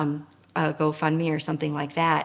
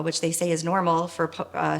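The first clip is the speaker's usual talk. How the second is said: ordinary delivery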